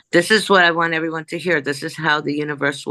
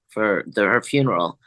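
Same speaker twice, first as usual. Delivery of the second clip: ordinary talk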